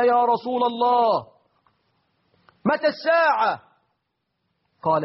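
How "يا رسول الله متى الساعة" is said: high, slow, drawn-out call of a Bedouin; interrupting Bedouin. Retold or imitated. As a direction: call out over the crowd like a Bedouin impatient for an answer. (أعرابي ينادي بصوت عالٍ وبطيء)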